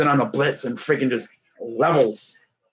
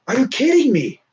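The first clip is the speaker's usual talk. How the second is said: higher, exasperated question; retold disbelief; 10 semitones higher than usual. Fan reliving a bad coaching call, exasperated and higher. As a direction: toss it up in mock outrage. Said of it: "I was like".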